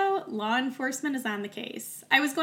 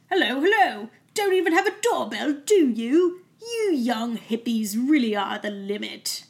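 pompous British constable voice, slowing at the end; terrible British accent; 2 semitones higher, 7 dB louder than usual. Retold or imitated. Podcaster imitating the constable barging in at night, hammed-up British accent. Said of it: "my terrible British accent".

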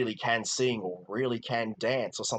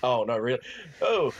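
sarcastic; 4 dB louder than usual. Pretend. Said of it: "my sarcasm meter".

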